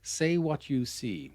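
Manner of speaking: ordinary talk, nothing marked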